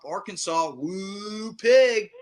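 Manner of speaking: loud Razorback hog call; hog call cheer. Real, not acted. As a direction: holler it like a cheering fan. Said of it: "that was awful".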